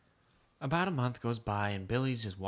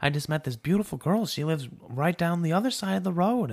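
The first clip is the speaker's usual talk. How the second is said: eager, higher-pitched news to Dad; breathlessly keen boy, lightly acted; excited son; 6 semitones higher than usual. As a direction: blurt it out with mock excitement. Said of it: "so, so excited".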